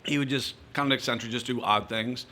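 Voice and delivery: ordinary talk, nothing marked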